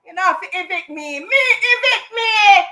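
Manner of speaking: sobbing, half-shouted defiance; crying outburst. Retold or imitated. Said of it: "I just let it out".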